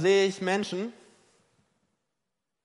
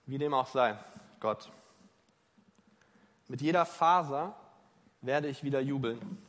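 lament prayer. Acted. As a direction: pour out the lament like a psalm. Acted lament prayer, psalm voiced.

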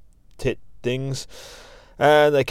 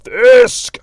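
screamed name, much higher and louder; villain scream; 12 semitones higher, 11 dB louder than usual. Acted. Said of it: "while screaming his name".

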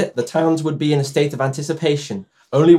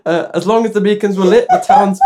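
louder, higher words breaking into laughter; laughing; 6 semitones higher, 5 dB louder than usual. Real, not acted. Host reading a Welsh myth, losing it to laughter. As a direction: try to read on but keep cracking up.

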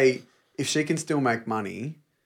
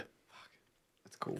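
whispered; 18 dB quieter, slower than usual. Real, not acted. Awed reaction to platinum badge.

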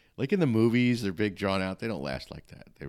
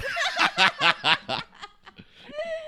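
long high burst of laughter; big real laugh; 6 dB louder than usual. Real, not acted. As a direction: crack up at the joke. Laughs after host's joke.

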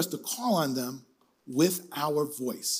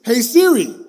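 raised, higher voice to wake a device; calling out; 6 semitones higher, 10 dB louder than usual. Real, not acted. Preacher demonstrating voice activation to the congregation, loud call. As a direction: call the assistant loudly.

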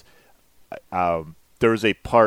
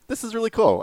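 excited; faster than usual. Retold or imitated. Retold excited reaction to movie.

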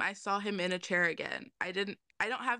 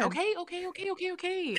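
nervous; 11 semitones higher, faster than usual. Real, not acted. Bracing himself, nervous.

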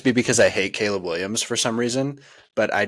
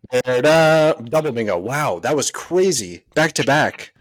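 excited; 5 semitones higher, 5 dB louder, slower than usual. Real, not acted. Celebrating a bingo call.

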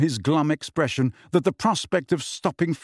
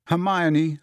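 ordinary delivery

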